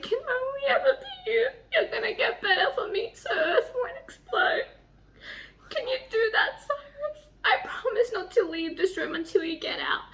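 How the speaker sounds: reading through real tears, barely holding on; crying while reading. Real, not acted. Reader sobbing over the dying hero's words. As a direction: voice breaking high, tearful laughs. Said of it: "Are you crying?".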